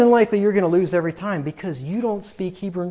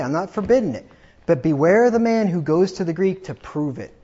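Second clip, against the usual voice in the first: ordinary talk, nothing marked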